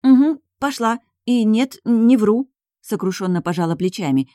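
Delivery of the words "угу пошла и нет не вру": high, emphatic show of being sorry; feigned regret. A narrator's reading. Heroine assuring the duke she is not lying, feigned regret. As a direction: insist plaintively, laying the contrition on thick. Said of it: "изображая неподдельное сожаление".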